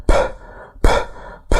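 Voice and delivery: "ب" as short voiceless p bursts, like panting; panting P puffs. Shown as a demonstration. Teacher likening it to panting, "ب" as airy p pops. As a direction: puff air through the lips on each "ب". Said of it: "كأنك كده بتنهج بالظبط".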